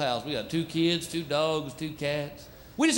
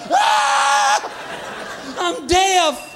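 mock deaf yell; 11 semitones higher, much slower than usual. Visitor pretending a barkless dog deafens him, hammed-up yell. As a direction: shout as if you can't hear. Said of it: "You're like".

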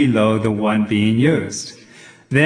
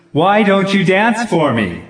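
frustrated high shout at the dancers; 8 semitones higher, 4 dB louder than usual. Acted. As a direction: yell it out in frustration. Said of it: "Doc shouted".

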